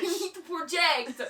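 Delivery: loud, high mock coach; projected coach voice. Shown as a demonstration. Host coaching co-hosts to project, mock coach voice. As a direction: project hard, coaching the room. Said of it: "Like, coach voice".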